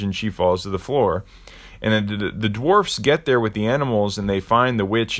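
usual speaking voice

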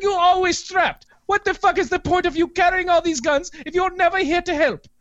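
high, exasperated mock Iranian accent. Clerk berating an armed regular for not helping, high Iranian accent. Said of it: "I've had that accent stuck in my head".

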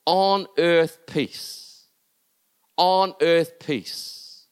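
very slow, every word weighted; slow emphatic. Acted. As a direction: lay each word down slowly. Preacher repeats key phrase.

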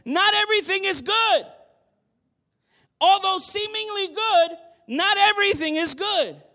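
loud, high preached warning; preaching Paul's answer. Retold or imitated. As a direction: proclaim it firmly to the congregation. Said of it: "Paul's response to that is".

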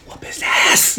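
hushed whispered cheer from a crowd; whisper-yell it like a spectator at a fight; drawn-out whispered egging on. Retold or imitated. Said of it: "they're whispering".

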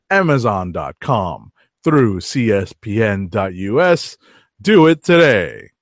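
upbeat sponsor read. Acted. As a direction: sell it like a radio spot. Reads the sponsor ad.

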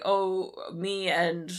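ordinary talk

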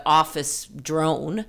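usual speaking voice

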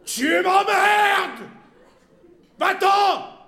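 coarse, furious high shout; washhouse bawl. A narrator's reading. Madame spewing abuse at monsieur, washhouse bawl. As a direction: bawl it out slowly like a washerwoman. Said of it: "Vomit madame d'une voix de lavoir".

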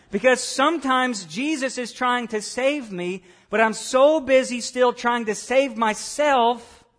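fiery pulpit preaching; preaching at full force. Real, not acted. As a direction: bring the sermon to a rousing peak.

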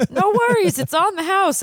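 giddy, wired dentist; high giddy doctor. Acted. Blatnoyd high on cocaine.

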